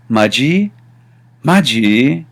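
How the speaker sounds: "Maji?" with the second syllable lifting; friendly question. Shown as a demonstration. Teacher shows a friendly "are you serious", rising on "Maji". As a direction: ask "Maji?" with a light upward swing. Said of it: "are you serious? And this is a very friendly way".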